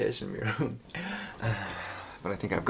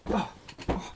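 usual speaking voice

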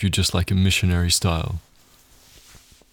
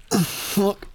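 a high, strained moan of pleasure; a moan that climbs and breaks; pleasured moan; 11 semitones higher, 4 dB quieter than usual. Acted. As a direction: groan it out as if losing control. Aroused moan mid-scene.

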